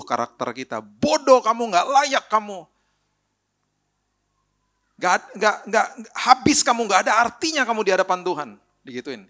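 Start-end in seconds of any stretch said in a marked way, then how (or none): 0.8-8.5 high, loud, sneering accusation from the devil; accusing devil. Retold or imitated. Preacher voices the devil accusing the sinner, loud and high. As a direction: spit insults like the devil condemning you. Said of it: "suara daripada si iblis yang nuduh".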